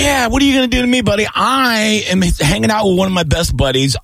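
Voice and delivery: high-pitched defiant boast; riled retort. Real, not acted. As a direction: fire back at the prank caller. Riled retort to prank caller.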